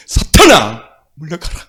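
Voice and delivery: sudden loud command that drops away; rebuking Satan. Retold or imitated. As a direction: bark the rebuke at the devil. Rebuking Satan in retold prayer.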